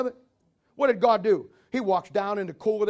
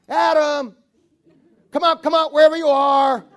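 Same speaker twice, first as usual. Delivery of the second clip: louder, higher hide-and-seek call; playful calling out; 5 semitones higher, 8 dB louder than usual. Retold or imitated. God calling hiding Adam out, playful loud call. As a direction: call like seeking a hiding child. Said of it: "And imagine God".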